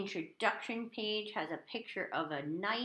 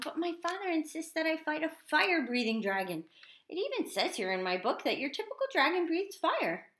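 high-pitched complaint; moaning knight; 8 semitones higher than usual. A narrator's reading. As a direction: moan it plaintively as the squeaky knight. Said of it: "Sir George moaned".

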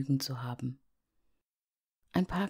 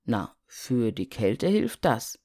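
low, slow snowman voice; a deep, slow, knowing snowman; snowman calling; 4 semitones lower than usual. Acted. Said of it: "rief der Schneemann". (tiefer, langsamer Schneemann)

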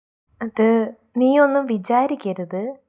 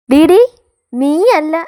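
loud yell, voice up high; furious yell; 5 semitones higher, 10 dB louder, much slower than usual. Acted. Mother-in-law shouting down her daughter-in-law, furious.

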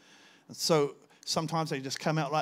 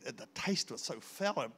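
ordinary talk, nothing marked

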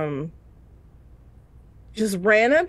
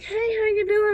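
high-pitched, breezy coworker hello; cheery greeting; 14 semitones higher than usual. Retold or imitated. Everybody at work asking after her, imitated chirpy greeting.

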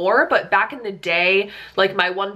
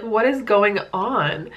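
ordinary talk

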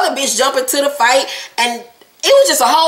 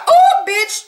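high "oh" sliding into slow words; drawn-out, pitched-up mock alarm; mock warning; 4 semitones higher, slower than usual. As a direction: imitate a stunned uh-oh reaction. Own reaction to the insult.

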